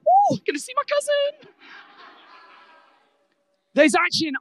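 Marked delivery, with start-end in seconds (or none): 0.1-1.5 high, light and carefree mock; breezy casual. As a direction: toss it off like a casual visit. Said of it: "this isn't like".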